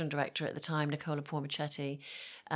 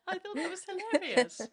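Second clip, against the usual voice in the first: higher, said through laughter; laughing; 13 semitones higher than usual. Real, not acted. Telling the host about the shop girl, still laughing. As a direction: keep laughing as you talk.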